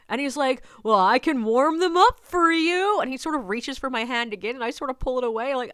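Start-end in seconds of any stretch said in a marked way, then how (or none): 0.8-3.0 slow, high, louder come-on; flirty stranger. Retold or imitated. Man offering to warm the guide's hands, flirty. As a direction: imitate a flirt, drawing it out. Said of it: "he's trying to flirt with me".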